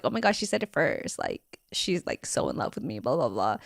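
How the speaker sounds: very soft mock guy voice, sinking low; mock a bragging guy under your breath. Girlfriend mimics guys telling people she said it first, mocking murmur. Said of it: "kinda like me mocking them".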